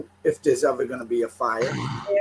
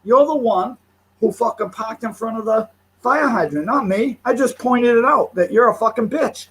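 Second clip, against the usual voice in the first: fast, higher, louder heated telling-off; indignant street argument voice; retold angry rant; 6 semitones higher, 3 dB louder than usual. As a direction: replay the argument like it's happening again, fast and loud. Retold shouting match with parked driver.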